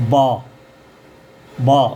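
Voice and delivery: बा held as an open "baa"; open AA vowel. Shown as a demonstration. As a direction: say बा with a long AA, no O. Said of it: "यहाँ पर हम पढ़ेंगे".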